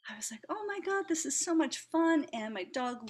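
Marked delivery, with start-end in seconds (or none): 0.5-2.3 high, bright burst of delight at the market; a retold rush of mock delight; excited gush. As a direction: retell the thrill of being out again, loud. Retelling delight at farmers market.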